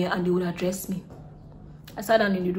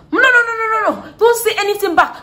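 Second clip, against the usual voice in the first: high, loud scolding mother cutting her off; mom shouting; 14 semitones higher, 9 dB louder than usual. Retold or imitated.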